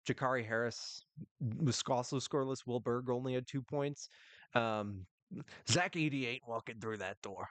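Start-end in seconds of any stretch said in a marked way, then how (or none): 5.7-7.5 a light nod to a coach's New York-ish delivery; lightly voiced Rick Pitino coach line; Rick Pitino impression. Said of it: "I'm doing a Rick Pitino accent".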